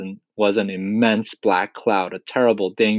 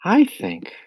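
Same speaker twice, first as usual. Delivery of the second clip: put-on higher boy's voice, thinking it out; slow, hesitant; 9 semitones higher than usual. A narrator's reading. James pondering the problem, slowly and higher. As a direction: begin tentatively in James's higher voice.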